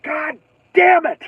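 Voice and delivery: slow, high, drawn-out curse; exasperated curse. Retold or imitated. Floor gets wet again, slow high exasperated curse. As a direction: drag out the damn in disbelief. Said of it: "I'm like".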